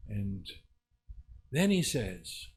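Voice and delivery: ordinary talk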